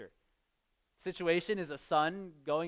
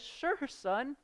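breezy dad, pitched higher, the scorpion line louder; mock cheerful dad; 7 semitones higher, 4 dB louder, slower than usual. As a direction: cheerfully hand over the scorpion. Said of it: "And the dad says".

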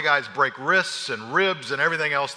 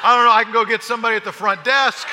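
laughing defeat; 5 semitones higher, 6 dB louder than usual. Retold or imitated. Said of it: "and I'm like".

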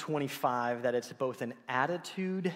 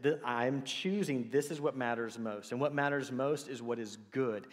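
usual speaking voice

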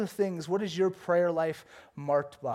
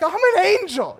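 very high, loud comic angel; hammed-up angel, way up in pitch; 12 semitones higher, 10 dB louder than usual. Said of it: "that was my angel voice".

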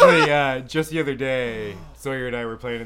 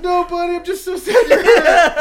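high, loud denial through laughter; laughing fake sympathy; 10 dB louder than usual. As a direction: pretend to feel sorry while cracking up. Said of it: "Are you laughing?".

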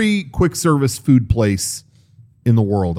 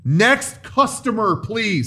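slow, drawn-out cashier call; clipped snippy cashier; 6 semitones higher, much slower than usual. Retold or imitated. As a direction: stretch it out like a bored cashier. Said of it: "Hey, they're calling you".

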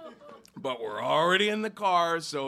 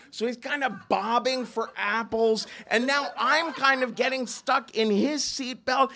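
usual speaking voice

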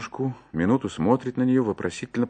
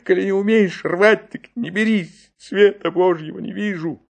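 tearful and mocking; 8 semitones higher than usual. A narrator's reading. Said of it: "плачущим и в то же время насмешливым голосом".

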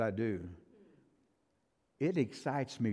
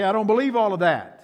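dismissive; 6 semitones higher, 9 dB louder, faster than usual. Retold or imitated. Mimicking closed-minded churchgoers.

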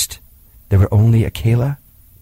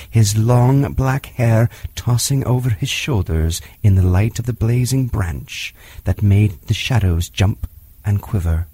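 plain reading voice

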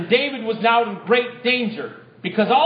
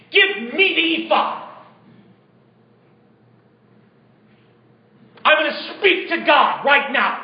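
urgent exclamation; 2 semitones higher than usual. Acted. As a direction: bark the order to the priest. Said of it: "It was an exclamation point".

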